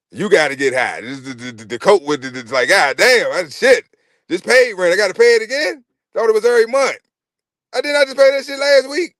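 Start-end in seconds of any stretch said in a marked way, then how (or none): 2.7-9.1 shocked renter. Retold or imitated. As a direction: act stunned that rent is due. Renter shocked at rent again.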